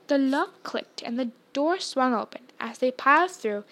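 ordinary delivery, nothing marked

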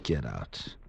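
low, quiet, seething order; low cold anger. Acted. Patient dismisses doctor coldly.

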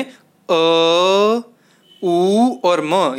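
"अ" and "उ" held long, then "म"; slow demonstration of "अ", "उ", "म"; evenly timed sounds. Shown as a demonstration. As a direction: stretch "अ", "उ", "म" out as separate, equal sounds. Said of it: "तीन को एकदम सेम टाइम".

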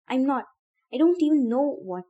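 a frantic scream that runs out of words; boy shrieking before he leaps. A narrator's reading. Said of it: "Ben screamed".

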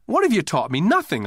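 put-on high, loud sulky boy; angry child. A narrator's reading. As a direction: snap back like a cross kid. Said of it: "I said angrily".

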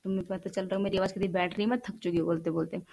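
normal talking voice

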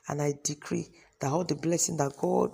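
ordinary talk, nothing marked

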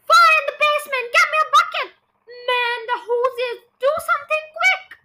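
frantic, high, loud commands; yelling. A narrator's reading. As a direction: shout urgent orders in a high-pitched panic. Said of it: "the old woman yelled".

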